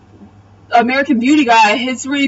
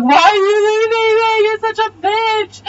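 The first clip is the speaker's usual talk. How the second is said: hammed-up high-pitched whine; mock wailing; 10 semitones higher than usual. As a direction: imitate an abandoned friend wailing. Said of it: "and she's crying".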